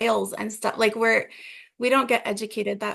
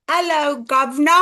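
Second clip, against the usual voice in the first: hammed-up British greeting, higher pitched; British accent; 3 semitones higher, 5 dB louder than usual. Shown as a demonstration. American guest practising British on English host, mock British accent. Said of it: "maybe just British".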